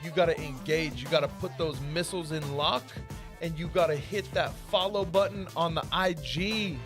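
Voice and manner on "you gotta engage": normal talking voice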